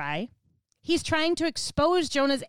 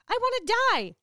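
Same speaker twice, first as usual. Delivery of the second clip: melodramatic wail, pitched way up; drama queen; 12 semitones higher, 4 dB louder than usual. Retold or imitated. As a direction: overplay it like a sulking drama queen. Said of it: "drama queen right here".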